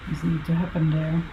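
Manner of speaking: normal talking voice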